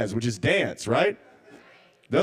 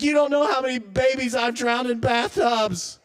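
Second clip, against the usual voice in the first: high, loud frantic confession; guilty shopper confessing; 8 semitones higher, 4 dB louder than usual. Retold or imitated. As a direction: blurt it out in a panic. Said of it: "She's like".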